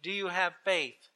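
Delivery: soft, composed question; calm heavenly voice. A narrator's reading. Voice from above questions tourist's faith, calm and soft. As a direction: probe quietly from above.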